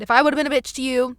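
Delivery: normal talking voice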